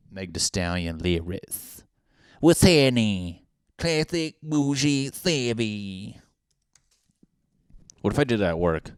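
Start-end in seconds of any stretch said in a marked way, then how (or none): 2.4-6.2 high, very slow, stretched out; drawn-out catchphrase. Pretend. Reading a TikTok caption aloud, slow drawn-out drawl. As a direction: savor each word like a catchphrase.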